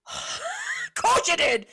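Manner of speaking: high, slow, sarcastic of course you did; exasperated. Retold or imitated. Waitress mocking a customer's claim in a high slow sarcastic drawl. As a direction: drag it out in weary sarcasm.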